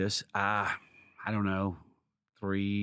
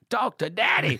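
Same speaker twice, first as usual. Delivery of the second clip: higher, louder cocky tease; playful greedy quip; 7 semitones higher, 4 dB louder than usual. Pretend. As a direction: savour it like a jokey boast. Joking greedy reaction to offer.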